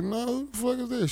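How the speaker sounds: lightly voiced baffled reply; mock puzzled retort. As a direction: act confused and a bit rude. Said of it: "She was like".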